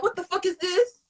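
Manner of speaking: high, incredulous what; shocked at herself; snapping out of it. Retold or imitated. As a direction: catch yourself, stunned. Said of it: "I be like".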